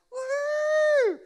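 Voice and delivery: high, loud joyful yell; wild shout. Pretend. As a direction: whoop with reckless joy. Family swims toward nets.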